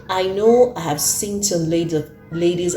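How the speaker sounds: ordinary delivery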